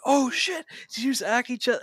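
high, loud burst of astonishment; hammed-up, high-pitched disbelief; mock shock. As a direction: blurt it out like a stunned onlooker. Acted stunned reaction after a pause.

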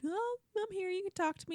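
high, soft mock-tender comfort; mock soothing Jed. Jed comforting Hannah, in a high, soft mock coo. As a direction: coo it like a fake sweetheart. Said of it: "And Jed's just like".

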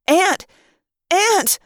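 high, sharp cry; inward scream. A narrator's reading. Trapped narrator tricks the termite mind, screaming inwardly. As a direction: scream the alarm word in your head. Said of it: "I screamed the word in my own head".

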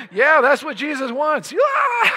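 high excited shout climbing to a squeal; wild cheering. Acted. Preacher telling congregation what Jesus wants, high hyped-up shout. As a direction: whoop it up for the crowd.

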